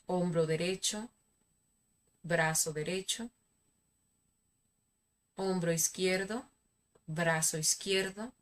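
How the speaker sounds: calm, soft meditation guide; guided meditation. Acted. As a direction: lead them gently into relaxation.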